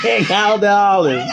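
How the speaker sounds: loud, high mimicked yell; mimicking shout. Copying the talk show clip, mimicking shout. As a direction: belt it out like the host on TV.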